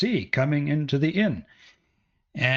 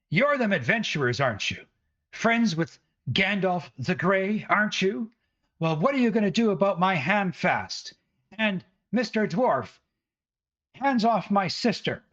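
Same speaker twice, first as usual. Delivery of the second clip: higher, scolding mother's voice; angry village mother; 8 semitones higher than usual. Acted. Mother with a broom berating the adventurers, high and scolding. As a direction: scold them like an outraged mother.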